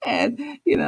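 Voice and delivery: ordinary talk, nothing marked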